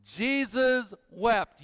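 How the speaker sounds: call-and-response verse, slow; slow recitation. Shown as a demonstration. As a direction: lead the room, *Jesus wept* spoken slowly. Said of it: "Once more".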